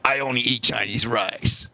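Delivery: low, slow, booming kung fu master voice; deep, drawn-out mock Shaolin greeting; mock kung fu monk. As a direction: play a solemn Shaolin monk from a martial arts film. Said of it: "I said".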